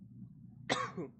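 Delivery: dry hacking cough; rough cough. Real, not acted. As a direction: clear a sore throat. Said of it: "with a bad voice".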